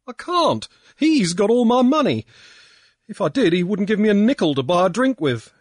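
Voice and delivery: put-on high, loud whining drunkard; a self-pitying drunk pleading; tearful drunk. A narrator's reading. Pankburn's tearful complaint.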